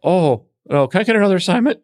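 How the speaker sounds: hammed-up alarm at being stuck with royalty; mock panic. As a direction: beg your boss to get you out of there. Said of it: "And I'm like".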